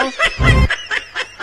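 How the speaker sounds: high burst of laughter; real laugh. Real, not acted. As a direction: laugh at your own joke. Host laughs at own joke.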